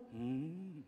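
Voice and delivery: ordinary talk, nothing marked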